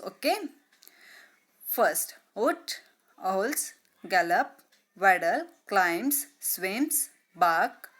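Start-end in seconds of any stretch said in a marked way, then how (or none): none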